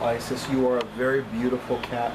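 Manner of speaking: ordinary talk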